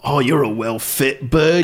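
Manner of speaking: drawn-out, loud Cockney leer; slow, lecherous Cockney catcall; Cockney old pervert. Acted. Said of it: "The one I always do".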